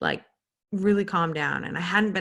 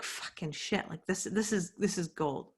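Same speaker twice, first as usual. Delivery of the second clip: hushed realization; 8 dB quieter than usual. Retold or imitated. Realizing something mid-story.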